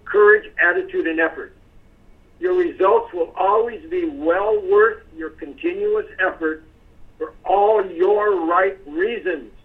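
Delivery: slowed down, every word weighted; slow emphatic reading. Shown as a demonstration. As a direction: read it out slowly, leaning on each word. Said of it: "What do we mean by that".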